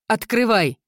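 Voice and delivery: high, loud command; shout. Acted. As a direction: call out urgently. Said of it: "крикнула Изабель".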